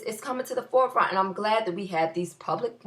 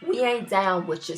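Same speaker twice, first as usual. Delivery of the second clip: ordinary talk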